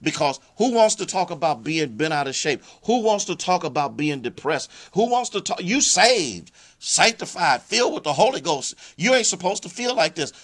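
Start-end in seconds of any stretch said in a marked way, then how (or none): 2.8-10.3 preaching climax. Acted. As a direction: rise into a full preaching peak. Preaching climax at full force.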